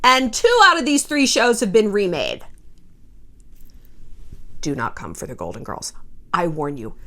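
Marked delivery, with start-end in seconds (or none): 4.6-6.9 much lower and softer, ominous; mock menacing warning. To would-be remakers, lowered mock threat.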